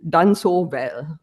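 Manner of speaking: "done so well" drawn out slowly, pitch falling to a close; end-of-sentence falling tune; slow final fall. Shown as a demonstration. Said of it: "as opposed to".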